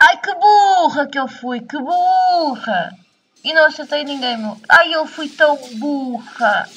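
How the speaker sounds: loud, whiny self-reproach; self-scolding wail. Real, not acted. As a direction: cry out at your own mistake. Berating herself after dying.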